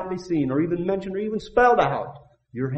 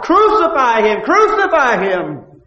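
loud, high mob chant, the second slower; crowd crying out; 10 semitones higher, 12 dB louder, slower than usual. Acted. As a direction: shout it like a jeering crowd. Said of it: "persuaded the people to cry out".

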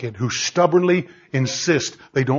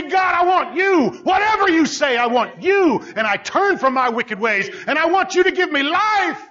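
high-pitched, urgent cry to God; impassioned sinner's plea, voiced by a preacher; fervent prayer; 11 semitones higher than usual.

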